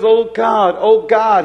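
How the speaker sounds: loud, pleading "oh God"; the Spirit crying out loud to God for the man. Acted. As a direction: cry it out to God, full voice.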